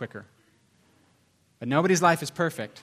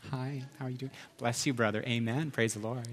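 much softer, put-on polite church greetings; fake churchy sweetness; 3 semitones lower, 8 dB quieter than usual. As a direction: play the smiling churchgoer hiding a fight. Churchgoers acting nice after fighting.

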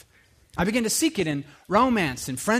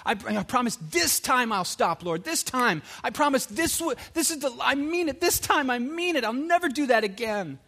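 higher, pleading, a touch of tearful desperation; imitating his own past begging, mild and strained; retold desperate prayer; 7 semitones higher than usual. A man retelling his desperate promise to God, pleading. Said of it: "I remember just weeping and just being like".

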